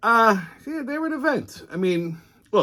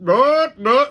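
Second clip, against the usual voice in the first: high, loud shouted no; retold shout; 11 semitones higher, 7 dB louder than usual. Teller retells shouting down the drunk, high and loud. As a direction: bark the refusal at him.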